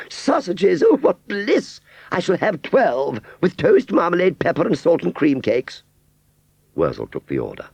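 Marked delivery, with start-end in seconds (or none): 0.1-5.8 high, loud, delighted list; blissful Aunt Sally. A narrator's reading. Aunt Sally ordering her dream breakfast, high and gleeful. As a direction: savour each dish with glee.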